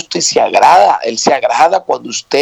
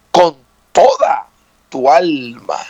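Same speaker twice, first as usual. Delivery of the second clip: slow, weighty "toda", then a pause; stress on TODA; slower than usual. Shown as a demonstration. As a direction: lean on "toda" before finishing the phrase. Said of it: "Escuche bien".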